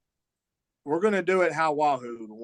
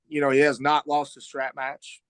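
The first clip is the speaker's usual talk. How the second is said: ordinary talk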